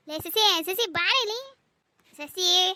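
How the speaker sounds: calling out. Acted. Husband calls out for his wife, loud. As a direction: call her name to fetch her.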